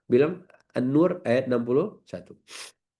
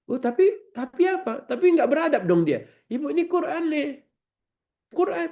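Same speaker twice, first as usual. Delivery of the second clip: mock protesting voice; 14 semitones higher than usual. Acted objector and reply.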